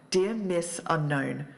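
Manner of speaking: ordinary talk, nothing marked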